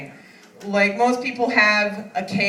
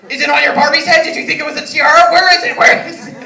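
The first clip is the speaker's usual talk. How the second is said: loud, high mock police interrogation; interrogating dad; 6 semitones higher, 10 dB louder, faster than usual. Grandpa interrogating his five-year-old granddaughter, loud mock interrogation. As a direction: grill her like a TV detective. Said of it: "He's like".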